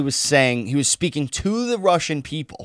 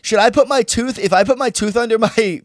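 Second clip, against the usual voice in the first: put-on higher, louder little kid asking; mock child voice; 7 semitones higher, 4 dB louder than usual. Joking as a scared kid asking about a tooth, higher mock child voice. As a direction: play a naive kid with a bedtime question. Mocking a kid's anxious question.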